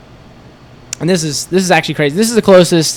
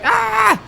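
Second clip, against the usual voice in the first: retold scream; 3 dB quieter than usual. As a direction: imitate her screaming without much volume. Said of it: "she starts screaming".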